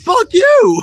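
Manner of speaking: very high, shouted comeback; high-pitched imitated shout; retold shouted retort. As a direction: yell it back in mock outrage. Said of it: "I looked at you and I was like".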